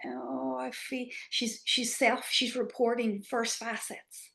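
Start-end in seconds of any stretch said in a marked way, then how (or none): 0.1-1.1 tentative client quote. Retold or imitated. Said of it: "It's a real kind of".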